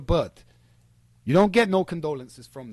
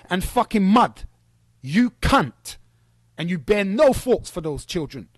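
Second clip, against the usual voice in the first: loud, furious shouting rant; real furious yelling; angry rant; 3 semitones higher, 8 dB louder than usual. Real, not acted. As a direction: tear into him, voice rising.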